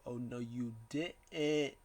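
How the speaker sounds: slow, drawn-out mock disbelief; lightly put-on sassy comeback, dragged slowly; Dexter Lumis. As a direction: stretch it out as a playful retort. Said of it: "Dexter Lumis is like".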